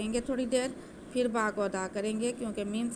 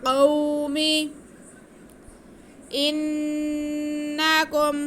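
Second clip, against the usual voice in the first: slow, drawn-out melodic tajweed recitation, higher and louder; Quran recitation; 5 semitones higher, 6 dB louder, much slower than usual. Shown as a demonstration. Teacher demonstrating the recitation to students, slow melodic chant. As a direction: chant it slowly with nasal ghunna. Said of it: "Hamza के नीचे जेर और नून मुशद्दद".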